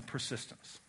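whispered single word; hushed whisper. Real, not acted. Names last key word, whispered.

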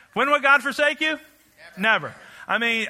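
ordinary talk

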